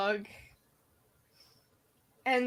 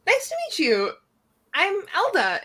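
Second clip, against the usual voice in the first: put-on bright high young character voice; high girlish voice; 9 semitones higher, 4 dB louder than usual. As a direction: greet in a high, sweet voice. Elda greets, in character.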